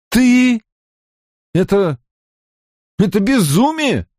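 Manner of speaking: high, halting horrified words; falter, then cry out in dismay; shocked disbelief. A narrator's reading. Mat aghast, gulps, stammers at friend. (ошеломлённо, срывающимся вверх тоном)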